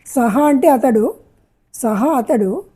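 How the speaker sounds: usual speaking voice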